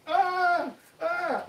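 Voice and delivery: high pained whimpers; imitated whimper. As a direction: whimper like the beaten bully. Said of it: "like this".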